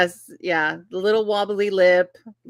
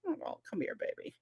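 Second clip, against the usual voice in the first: much softer cooing; tender comfort; 8 semitones higher, 17 dB quieter than usual. Retold or imitated. Mother soothing toddler's wobbly lip, soft coo. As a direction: coo softly to a hurt child. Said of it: "wobbly lip".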